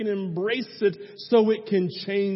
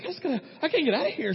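rushed, high-pitched retold panic; quick, agitated mock panic; panicked urge to flee; 7 semitones higher, much faster than usual. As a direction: imitate someone desperate to get out. Woman desperate to flee stage.

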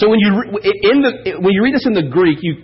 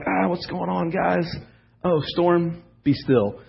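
a hint of groggy, softer voice; just woken, mumbled and quiet; lightly voiced sleepy Jesus calming the storm; 8 dB quieter, slower than usual. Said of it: "I imagine he's kinda, like, groggy".

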